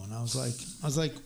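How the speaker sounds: usual speaking voice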